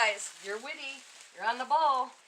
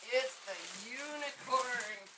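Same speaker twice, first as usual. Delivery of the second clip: slow and softer, savoring each word; slow, soft reveal; 9 dB quieter, slower than usual. Shown as a demonstration. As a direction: drag it out in a hush for effect. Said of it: "repeated reveal, drawn out".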